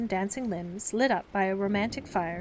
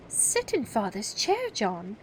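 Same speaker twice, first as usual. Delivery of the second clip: scandalized; slower than usual. Acted. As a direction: sound properly scandalized. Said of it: "Wendy was scandalized".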